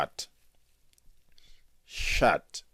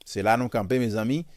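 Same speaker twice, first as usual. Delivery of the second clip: usual speaking voice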